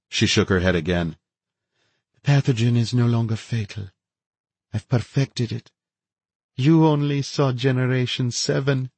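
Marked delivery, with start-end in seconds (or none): 2.1-8.9 higher, eerie feverish woman; gloating infected scientist, serene; unhinged woman. A narrator's reading. Narrator voices the infected woman.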